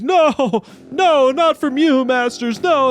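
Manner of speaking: very high, drawn-out mock anguish; squeaky, slow pretend wail; hammed-up wailing victim. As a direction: plead pathetically as if mortally wounded. Mimics Savi wailing at insults.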